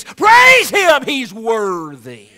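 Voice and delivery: high, loud call to praise; full-voiced exhortation; rousing shout. Real, not acted. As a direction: lift the room with it. Preacher calls congregation to praise.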